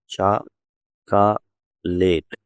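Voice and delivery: "చాకలేట్" very slowly as "chaa... ka... late"; syllable by syllable. Shown as a demonstration. Teacher breaks it down for learners, "చాకలేట్" in slow syllables. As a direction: pause after each syllable of "చాకలేట్", middle one "ka". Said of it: "ఓ ఎగరేస్తారు".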